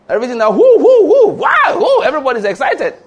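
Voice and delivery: high, loud whooping crowd imitation; hoop and holler. Preacher mocking a hoop and holler congregation, loud whoops. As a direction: mimic a rowdy shouting church. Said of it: "the hoop and holler church".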